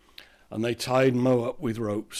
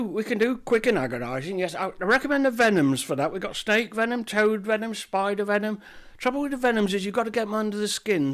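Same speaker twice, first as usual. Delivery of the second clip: much higher, louder, eager shopkeeper; chirpy shopkeeper; 8 semitones higher, 4 dB louder than usual. Acted. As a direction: greet him like a fussy shopkeeper. Apothecary lists venoms.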